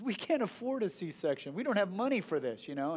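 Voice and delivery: ordinary talk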